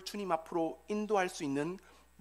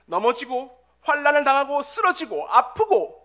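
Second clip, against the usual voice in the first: loud, raised-pitch preaching; forceful preaching; 8 semitones higher, 9 dB louder than usual. Real, not acted. Preacher telling the congregation of rejection and frustration, impassioned preaching. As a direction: drive each phrase home, building to a high peak.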